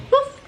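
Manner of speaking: high playful woof; dog woof. Pretend. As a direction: bark like a puppy for the baby. Imitating dog for toddler.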